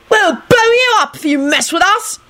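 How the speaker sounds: very high, menacing cry; shouted threat. A narrator's reading. Tim warning the cursed clothes, shouting. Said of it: "he shouted".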